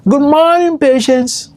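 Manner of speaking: high, singsong imitated ward greeting; chief nurse's greeting. Chief nurse greeting the emergency ward, high singsong imitation. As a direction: call it out high like a nurse on rounds. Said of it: "and then she greeted".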